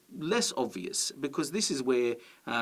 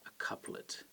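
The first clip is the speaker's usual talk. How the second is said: whisper; 13 dB quieter than usual. Shown as a demonstration. Teacher whispering the word couplet to class. Teacher naming the two-line unit.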